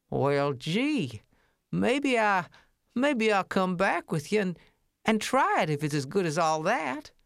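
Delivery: a hesitant, folksy little mouse voice; gee-whiz country cousin, unsure. Acted.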